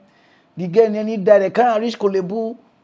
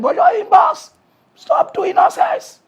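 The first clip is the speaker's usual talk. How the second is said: louder, pitch jumping way up in scolding; high exasperated scolding; 4 dB louder, slower than usual. Real, not acted. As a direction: tell them off sharply. Scolding audience.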